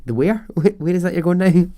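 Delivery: amused, puzzled double-take; mock confusion. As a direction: play baffled by what you just heard. Acting baffled listener to Belfast speaker.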